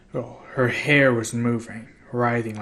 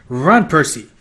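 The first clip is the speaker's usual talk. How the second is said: urgent shout; 3 semitones higher, 8 dB louder than usual. A narrator's reading. Annabeth calling a warning.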